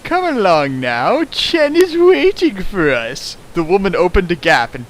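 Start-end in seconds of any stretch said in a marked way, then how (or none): 0.0-3.4 high mistress voice. Acted. Yukari voiced, fanfic reading.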